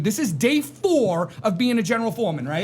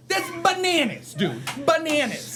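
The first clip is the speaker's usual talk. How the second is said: exclaiming; 9 semitones higher, slower than usual. Real, not acted. Exclaiming about the story.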